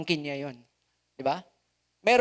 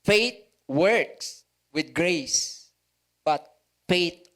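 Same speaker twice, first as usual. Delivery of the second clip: slow quoted saying; much slower than usual. Acted.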